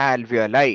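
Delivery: alveoli pronounced al-vee-o-lai; pronunciation model. Shown as a demonstration. Teacher giving student the right answer, alveoli pronounced al-vee-o-lai. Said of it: "you should learn the pronunciation".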